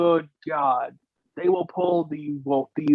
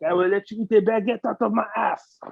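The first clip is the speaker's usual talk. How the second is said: higher, quick cartoon French; mock French accent; 4 semitones higher, faster than usual. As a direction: crude hammed-up Frenchwoman with a put-on accent. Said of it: "how we do it in French".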